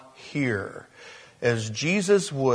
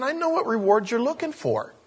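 higher, lightly acted knowing tone; God's knowing reply; 9 semitones higher than usual. God answering those seeking applause, knowing and pointed. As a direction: concede it pointedly, seeing through the motive. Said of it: "God's saying".

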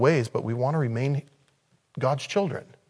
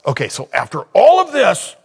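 ordinary talk

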